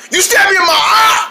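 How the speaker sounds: high, loud outraged yell; indignant yell. Acted. Hot dog yelling at the rabbit in outrage. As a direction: blast the accusation. Acted accusation repeated.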